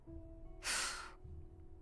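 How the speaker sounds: long, soft exhaled sigh; regretful sigh. Acted. Teacher admitting she should have called the cavalry, soft sigh. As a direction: let out a quiet, drawn-out sigh.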